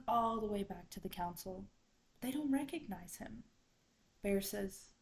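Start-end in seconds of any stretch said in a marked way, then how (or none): none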